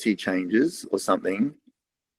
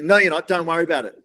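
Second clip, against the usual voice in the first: mock huffy wife; 6 semitones higher than usual. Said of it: "like this".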